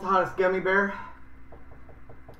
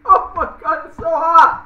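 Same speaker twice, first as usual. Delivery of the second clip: high, loud burning cry; pained yell; 9 semitones higher, 8 dB louder than usual. Real, not acted. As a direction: yell from the heat in your mouth. Hot candy burns mouth.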